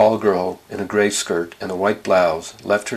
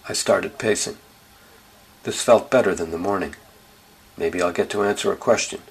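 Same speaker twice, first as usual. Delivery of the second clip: plain reading voice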